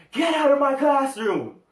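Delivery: high, loud yell at the whole room; furious shout. Retold or imitated. Teacher throwing the whole class out, furious high shout. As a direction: explode at the class.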